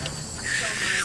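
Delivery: soft unvoiced shush; librarian shushing whisper. Real, not acted. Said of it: "we're in the library now".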